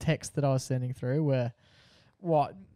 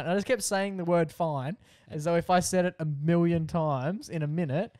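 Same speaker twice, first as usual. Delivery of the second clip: plain reading voice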